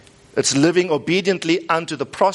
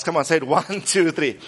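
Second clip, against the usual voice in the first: normal talking voice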